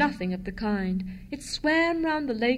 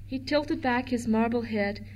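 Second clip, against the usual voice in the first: ordinary delivery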